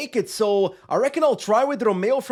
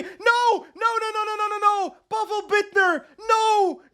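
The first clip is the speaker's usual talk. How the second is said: high, anguished cries of no; despairing shout; 10 semitones higher than usual. Real, not acted. Gamer sees the peloton catch his rider, anguished high shouting. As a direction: watch the win slip away and cry out.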